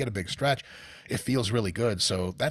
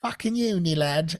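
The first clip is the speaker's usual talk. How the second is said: put-on higher-pitched British voice; British accent; 7 semitones higher, 4 dB louder than usual. Reading a British website's name to the guys, mock British accent. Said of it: "than the British voice".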